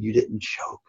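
hushed, breathy reassurance; soft whisper. Retold or imitated. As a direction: whisper comfort to a crushed kid. Coach consoling crying player.

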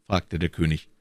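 ordinary talk

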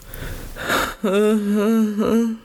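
long, weary zombie moan; zombie groan. Shown as a demonstration. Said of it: "I'm just sort of zombie noises".

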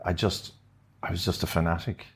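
ordinary talk, nothing marked

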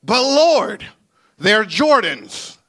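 loud, hammed-up objection; pleading protest. Preacher arguing with the Lord over his Jordans, loud mock pleading. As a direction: plead your case at full voice, starting high.

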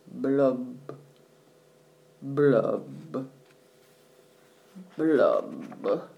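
low gloomy bubble blubs; slow sinking blub sounds. A narrator's reading.

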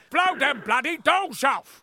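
slow, drawn-out Cockney shout; a hammed-up London accent; Michael Caine impression. Shown as a demonstration. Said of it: "speak like Michael Caine".

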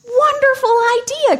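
very high, loud delighted cry; king cries out in delight; cried out. Acted. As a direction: burst out with delight. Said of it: "cried Xerxes".

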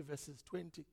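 ordinary talk

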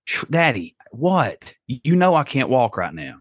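a hint of a tired small daughter; father imitating his daughter who can't walk; child's voice. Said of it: "she was like".

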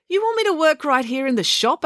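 can't believe it, pitch up; indignant disbelief. A narrator's reading. Selby protests in disbelief.

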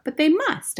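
put-on high, loud boyish cry; desperate boy shouting; cried out. A narrator's reading. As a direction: protest it, a child crying out. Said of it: "I cried".

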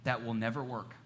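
soft, low, careful correction; quiet, gently firm; very gentle. Real, not acted. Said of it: "very gently".

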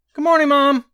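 put-on high, loud child's greeting; excited young son. A narrator's reading. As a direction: call out like a happy boy. Young son greets his mother.

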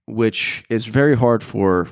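ordinary talk

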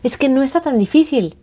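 a light, delighted gasp of insight; amazed realization. Retold or imitated. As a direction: marvel that it finally clicked. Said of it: "Y es como".

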